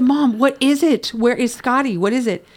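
high, loud, urgent re-enacted demand; retold panicked questions; desperate questioning. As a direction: plead with mom for an answer. Retells begging mother for news.

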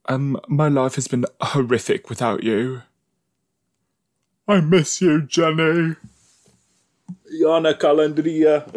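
high put-on pining voice; mock lovelorn lament. Viewer mimics a character missing Jenny, mock lovelorn.

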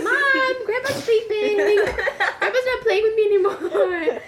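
put-on very high, loud whiny child voice; imitated little kid. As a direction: whine to mom like a small child. Kid telling mom, voiced in story.

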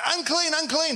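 high-pitched warning call; mock yell. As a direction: cry out to keep people away. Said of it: "he has to yell".

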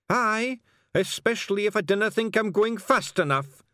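high, loud Scots voice; high-pitched, insistent Scottish passenger. A narrator's reading. Passenger hurrying the carter along, loud high Scots.

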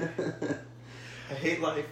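drawn-out, slow mock despair. Real, not acted. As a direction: drag it out like your life is over. Despair after bad Cowboys pick.

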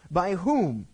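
mock impatient king, fast and pushy; impatient demand. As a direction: rush the questions impatiently. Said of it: "Ahab turns around and goes".

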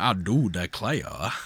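put-on a slow Southern gentleman accent. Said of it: "very thick kind of southern accent".

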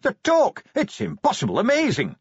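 high, loud bursts of wonder; excited shout. Acted. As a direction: shout out in sheer amazement. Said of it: "An excited voice behind him shouted".

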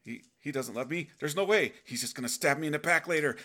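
hammed-up fearful protest; mock panic, pushing love away; panicked refusal. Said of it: "You're like".

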